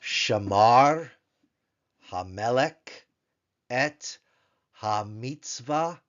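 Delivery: slow Hebrew "Shamar, Hamelech, et Hamitzvah."; Hebrew reading. Shown as a demonstration. Teacher reads the example sentence to class, slowly. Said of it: "take a look and see".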